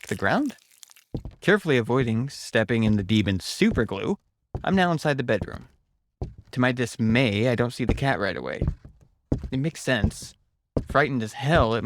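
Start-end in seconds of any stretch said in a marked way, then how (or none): none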